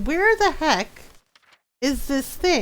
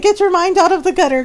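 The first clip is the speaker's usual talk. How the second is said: louder, higher, fast mock telling-off; mock scolding; 7 semitones higher, 7 dB louder, faster than usual. Player retorting to crude requests, mock scolding. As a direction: scold them in play, quick and loud.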